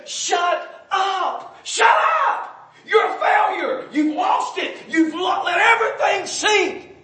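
screamed high and very loud at the failures; furious shout. Retold or imitated. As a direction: yell at them like you'd grab their throat. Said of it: "pull them out by the throat and say".

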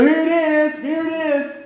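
high-pitched showy proclamation; mock marquee announcement. Preacher mocks God advertising miracles, mock announcement. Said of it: "with marquee signs".

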